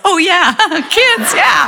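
high, laughing yell of sudden remembering; sheepish yell. Retold or imitated. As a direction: shout as if you just remembered the kids. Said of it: "And my dad yells".

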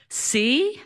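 high, rising husband voice; smug husband voice. Acted. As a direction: pipe it up high, scoring a point. Husband's line voiced again.

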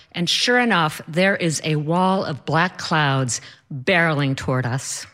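ordinary talk, nothing marked

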